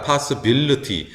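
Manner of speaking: "possibility" as pas-uh-BIL-uh-tee; weak "uh" vowels in "possibility", stress on BIL; reduced schwa vowel. Shown as a demonstration. Teacher building the long word, unstressed vowels reduced. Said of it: "강세가 없기 때문에 어로".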